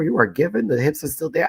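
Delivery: high, loud imitation of a gushing fan; gushing compliment. Fan complimenting creator's body at DragCon, high gushing imitation. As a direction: mimic someone hyping up a friend.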